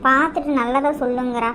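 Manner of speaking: ordinary talk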